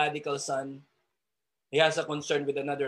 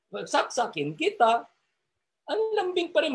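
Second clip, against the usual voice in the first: a threat sung in a much higher sweet Ilonggo tune; Ilonggo lilt; 10 semitones higher than usual. Retold or imitated. Preacher mimicking an Ilonggo threatening sweetly. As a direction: make the threat sound tender, Ilonggo style. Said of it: "Ang sarap gayahin ng kanilang tonality".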